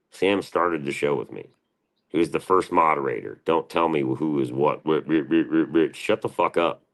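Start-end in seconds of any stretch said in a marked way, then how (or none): none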